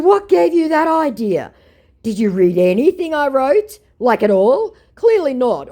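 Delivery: reading her own angry message out loud; exasperated shout. Retold or imitated. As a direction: let him have it in disbelief.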